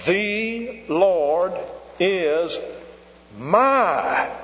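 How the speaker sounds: high, word by word, landing hard on "my"; teacher spelling out the phrase, pausing before a big "my"; stress on my. Retold or imitated. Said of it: "Put the emphasis where it ought".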